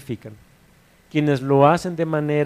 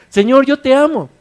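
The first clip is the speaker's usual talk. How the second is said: much higher and louder, fervent; fervent declaration; 11 semitones higher, 9 dB louder than usual. Pretend. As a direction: cry it out with full devotion. Believer declares love to Lord.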